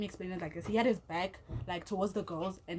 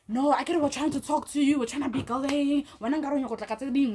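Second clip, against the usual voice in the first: higher, louder, insistent mimicry; protesting ex; 6 semitones higher, 4 dB louder than usual. The ex insisting they were trying to talk, in a raised protesting voice. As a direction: imitate the ex's heated protest.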